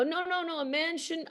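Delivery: high mock protest; mock upset protest. Men telling her a man shouldn't cry, high mock-upset protest. Retold tearful men refusing comfort.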